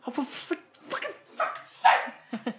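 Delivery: high, exasperated curse, drawn out slow; real annoyance at the fan, cursing slowly; frustrated outburst. Real, not acted.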